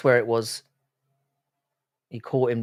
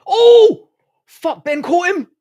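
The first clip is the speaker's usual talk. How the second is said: loud, high yell of shock, slower at the end; excited shout; 12 dB louder, much slower than usual. Real, not acted. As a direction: yell as the punch lands. Big punch lands in fight.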